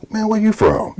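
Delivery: high-pitched incredulous question; puzzled onlooker. Retold or imitated. Listeners baffled by good English, high mocking voice. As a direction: put on a high voice of someone baffled by you. Mimicking people's puzzled reaction.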